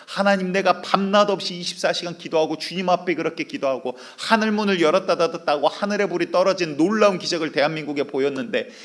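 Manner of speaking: a worn-out preacher crying out to God; loud despairing prayer. Acted. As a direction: cry out that the nation is finished. Modern prophet despairing to God.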